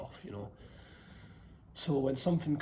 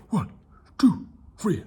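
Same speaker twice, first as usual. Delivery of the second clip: barking gym coach; 5 semitones higher, 9 dB louder than usual. Acted. Counting push-ups as drill coach.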